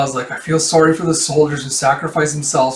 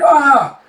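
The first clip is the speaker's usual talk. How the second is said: sharp high yelp of surprise; startled yelp; 11 semitones higher, 7 dB louder than usual. Acted. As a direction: yelp in sudden shock. Sudden startled cry.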